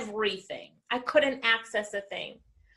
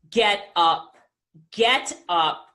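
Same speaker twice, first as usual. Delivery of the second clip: deep Les Brown voice; 5 semitones lower, much slower than usual. Retold or imitated. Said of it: "in his deep Les Brown voice".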